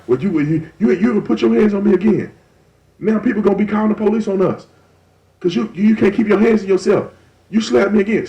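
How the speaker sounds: angry partner. Retold or imitated. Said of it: "they like".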